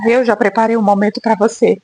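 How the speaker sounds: low, calm voice of God speaking to her. Retold or imitated. A voz de Deus, grave e calma. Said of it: "falou assim".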